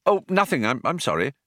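mildly flustered, starting high and dropping; embarrassed. A narrator's reading. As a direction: back out of it with a touch of embarrassment. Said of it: "said Arthur in embarrassment".

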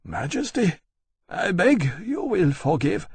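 lightly voiced general near tears; voice almost breaking. A narrator's reading. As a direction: beg forgiveness, barely holding it together. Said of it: "his voice almost breaking".

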